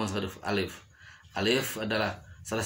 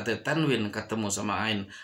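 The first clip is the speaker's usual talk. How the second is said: ordinary talk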